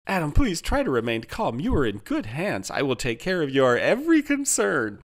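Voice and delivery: high, insistent customer-service soothing; upbeat, high-pitched placating; placating AI helper. A narrator's reading. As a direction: talk over the upset customer with scripted reassurance.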